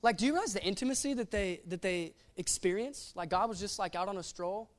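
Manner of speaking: ordinary talk, nothing marked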